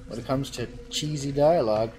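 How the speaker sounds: ordinary talk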